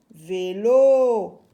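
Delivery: drawn-out vé, then a strong long lo; syllable by syllable. Shown as a demonstration. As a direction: sound out Vélo slowly and firmly. Teacher sounds out the word.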